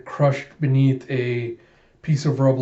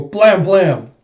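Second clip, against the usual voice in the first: loud gun blasts; 6 semitones higher, 7 dB louder than usual. Retold or imitated. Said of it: "and you go".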